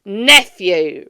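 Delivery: loud, hard, stern reply. A narrator's reading. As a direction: bark his name back. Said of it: "returned the uncle sternly".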